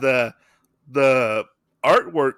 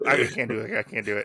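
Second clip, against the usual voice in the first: usual speaking voice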